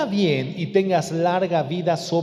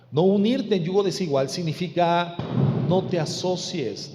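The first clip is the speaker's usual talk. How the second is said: ordinary talk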